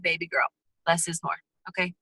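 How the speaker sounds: a hint of a mom gently scolding her little girl; mom's advice. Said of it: "your mom's like".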